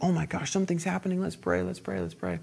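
very soft, hurried imitation of concern; hushed urgent prayer. Acts staff urging hushed prayer.

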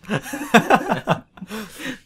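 laughing loudly; high burst of laughter. Real, not acted. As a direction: break into giggles. Laughs at own reading.

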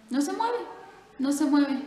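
astonished rising cry; surprised. Acted. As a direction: squeak it out, amazed. Parrot won't move, teller marvels.

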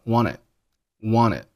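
"wanted" said as "wanna" with dropped t; reduced "wanted". Shown as a demonstration. Teacher demonstrating "wanted" with its t dropped. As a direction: drop the middle t of "wanted". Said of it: "这个单词是读成了wanted".

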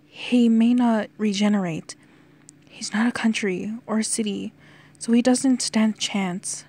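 ordinary delivery, nothing marked

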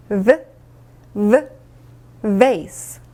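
"V, V, vase" as buzzing v sounds, then the word; slow v drill. Shown as a demonstration. Teacher for students to repeat, slow sounded-out "V, V, vase". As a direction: isolate the v twice, pause, then "vase". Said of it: "천천히 한번 따라 읽어볼게요".

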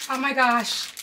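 ordinary talk, nothing marked

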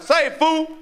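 high, drawn-out call of Seafood; the guys on the corner heckling, a high teasing call. Retold or imitated. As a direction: call it out across the street.

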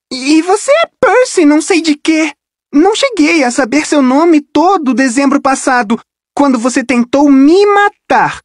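voz aguda de menina, cortante; voz de garota acusadora. Acted. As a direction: acuse com sarcasmo, voz de garota. Rachel acusa Percy. (Rachel lembra Percy do ataque, acusadora)

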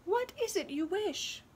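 high little bird voice; chirping wren. Acted. Wren asks the girl her wish, high chirp. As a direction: ask it in a friendly chirp. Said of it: "the wren chirped".

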